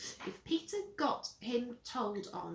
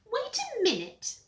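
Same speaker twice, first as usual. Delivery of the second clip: put-on high, loud child voice; whiny little brother; 13 semitones higher, 7 dB louder than usual. Peter catching Henry in his room, high whine. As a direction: pipe up in a high brat voice.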